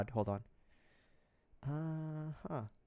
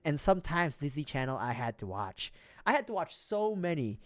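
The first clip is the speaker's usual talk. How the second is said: ordinary talk, nothing marked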